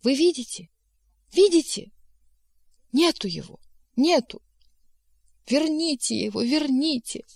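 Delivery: high, distressed shrieked pleading; frantic cry. A narrator's reading. Beautiful secretary pleading for her vanished boss back, frantic high cry. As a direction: cry out in short desperate bursts. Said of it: "вскричала".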